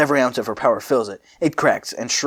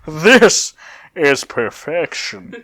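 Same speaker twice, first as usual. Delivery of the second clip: smug Cell; 5 semitones higher, 4 dB louder, much slower than usual. Acted. Cell voiced in the story.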